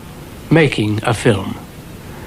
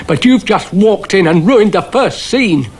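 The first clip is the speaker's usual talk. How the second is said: patient complaint; 8 semitones higher than usual. A narrator's reading. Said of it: "said Dougal patiently".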